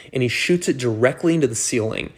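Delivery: normal talking voice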